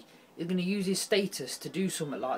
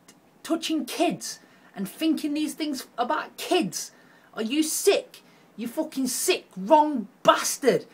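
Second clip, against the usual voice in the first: high, loud appalled yelling; wrong bastard dragged out slowly; disgusted outrage; 10 semitones higher, 4 dB louder, slower than usual. Real, not acted. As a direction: spit the insults out in revulsion. Raging at the convicted singer.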